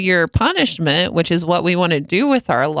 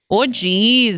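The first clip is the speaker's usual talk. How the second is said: Minnesota accent; 3 semitones higher than usual. Shown as a demonstration. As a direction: say Oh, jeez like a Minnesotan. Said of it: "do it in a Minnesotan accent".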